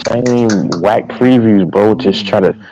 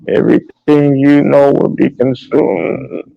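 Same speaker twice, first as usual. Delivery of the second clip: slow, high, eerie movie-villain voice; Scourge; 8 semitones higher, slower than usual. Retold or imitated. Friend imitating a scary movie villain for his buddies, slow and menacing. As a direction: draw it out like an ominous villain. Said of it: "the way he talks, he's like".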